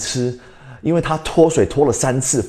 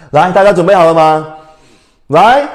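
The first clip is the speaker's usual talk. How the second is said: loud rallying call; 5 semitones higher, 8 dB louder than usual. Real, not acted. Teacher rallies the class.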